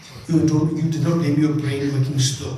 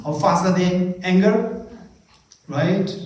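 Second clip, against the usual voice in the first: normal talking voice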